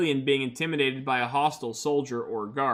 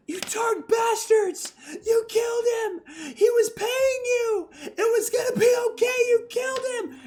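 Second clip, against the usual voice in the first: high, anguished shrieks with a crying edge; grief-stricken screaming. Acted. Grieving alien woman screaming at Targ soldiers over a corpse. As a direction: scream it through tears at the killers. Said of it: "screams bloody murder".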